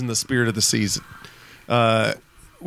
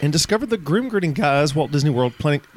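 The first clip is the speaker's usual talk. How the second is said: ordinary talk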